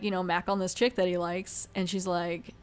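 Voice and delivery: normal talking voice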